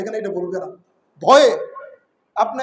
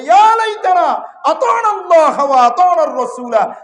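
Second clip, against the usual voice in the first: crying out; 4 semitones higher, 4 dB louder than usual. Acted. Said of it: "চিৎকার দিয়ে বলবে".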